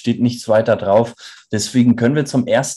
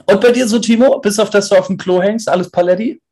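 high teasing voice; 9 semitones higher, faster than usual. Real, not acted. Teasing question to co-host.

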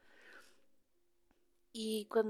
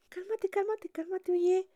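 soothing plea; 10 semitones higher, 5 dB louder than usual. Retold or imitated. Wife calming angry husband.

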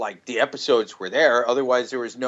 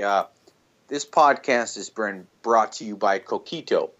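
ordinary talk, nothing marked

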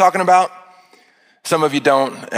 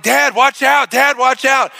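higher panicked warning; 6 semitones higher than usual. Retold or imitated. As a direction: warn dad, panicked and higher. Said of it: "And I panicked".

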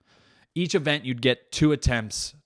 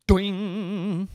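high, wobbling cartoon "doing"; long boing noise; springboard twang; 9 semitones higher than usual. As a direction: imitate a spring twanging on launch. Imitating the springboard launch noise.

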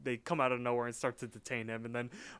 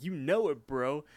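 higher, louder mock bravado; retold cocky reply; 3 semitones higher, 5 dB louder than usual. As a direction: play the confident bro, punching it up.

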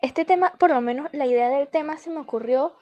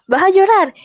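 mother teasing; 7 semitones higher, 8 dB louder than usual. Retold or imitated. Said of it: "me vio y me dijo".

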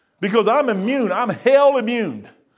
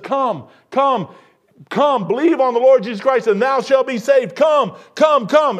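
raised, high preacher's summons; urgent calling; 4 semitones higher, 3 dB louder than usual. Acted. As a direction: call the lost forward with rising force. Voices Christ calling sinners to come.

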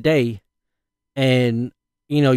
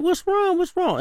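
high, urgent repeated question; alarmed parent asking fast; much higher anxious repeat; worried. Retold or imitated. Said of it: "I'm like".

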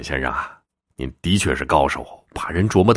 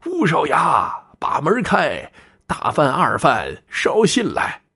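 high-pitched, drawn-out call; calling out loudly; 10 semitones higher, slower than usual. A narrator's reading. Traveller calls the secret words to the cliff, high calling voice. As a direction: call out to a stone cliff, stretching the last phrase. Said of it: "朝着石衙大声地说".

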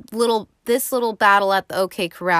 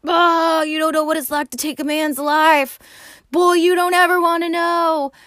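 much higher, louder hammed-up yell; real mad; 9 semitones higher, 6 dB louder than usual. Wyatt yelling at his brothers about killing, hammed-up loud anger. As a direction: bellow it like a furious older brother. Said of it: "he's just real mad".

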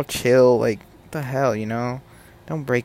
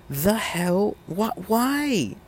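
pitched high, disbelieving "why?"; incredulous why; 8 semitones higher, much slower than usual. Real, not acted. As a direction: react as if it makes no sense at all. Outraged at PlayStation exclusivity.

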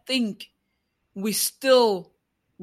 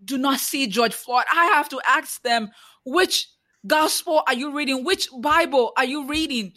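impassioned preacher, higher and louder; preaching; 5 semitones higher, 3 dB louder than usual. Acted. Challenging Christians who do not see the oppressed, preaching. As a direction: preach it, pressing each question.

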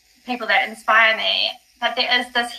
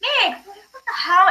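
surprised call; 9 semitones higher, slower than usual. Retold or imitated. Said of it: "I was like".

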